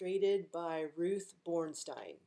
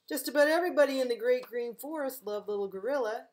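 fast, louder, higher cheerful read; lively storytelling; 5 semitones higher, 6 dB louder, faster than usual. A narrator's reading. Librarian reading to kids, fast and bright. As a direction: rush it out with delight.

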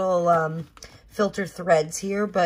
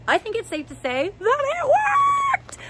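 very high, loud, drawn-out cheer; triumphant shout; 5 dB louder than usual. Real, not acted. Vlogger announcing the filter experiment's success, triumphant shout. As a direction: shout the verdict in triumph, stretching it out.

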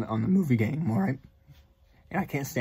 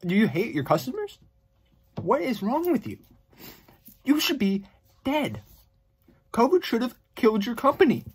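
furious rant; 9 semitones higher than usual. Real, not acted. Vlogger yells at AMC over ticket prices, furious. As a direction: lash out at the company.

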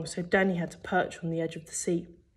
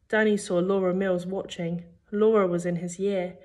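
ordinary delivery, nothing marked

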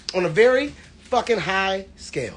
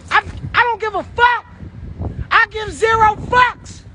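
hyped up; 9 semitones higher than usual. Real, not acted. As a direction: yell it out all fired up. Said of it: "Y'all ain't never seen me this hype".

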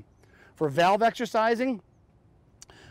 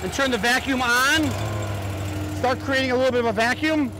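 raised, higher voice over machinery; shouting over engine; 4 semitones higher than usual. Real, not acted. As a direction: project over the running engine. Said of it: "it may be hard for you to hear me".

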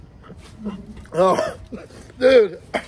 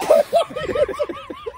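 long high-pitched cackle; high cackling laugh. Real, not acted. Friend cracking up at the misery, high cackle. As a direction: burst into helpless high laughter.

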